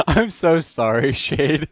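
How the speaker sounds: slow, loud, through giggles; laughing apology. Real, not acted. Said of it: "I have to calm down".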